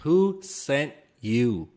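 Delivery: very slow, drawn-out accusing question; mock interrogation. Suspicious friend questioning his past, slow mock interrogation. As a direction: space out each word like a suspicious interrogator.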